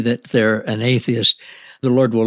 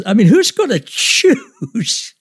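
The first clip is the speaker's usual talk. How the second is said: laughing; 12 semitones higher, 4 dB louder, much slower than usual. Real, not acted. Still laughing at the story.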